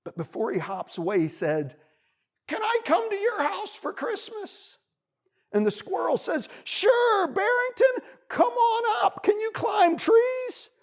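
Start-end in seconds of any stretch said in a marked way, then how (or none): 2.5-4.8 very high, small pleading voice; timid bunny. A narrator's reading. Bunny asks squirrel to host him, timidly high. 6.6-10.7 friendly squirrel calling. A narrator's reading. Squirrel invites bunny up the tree, calling down.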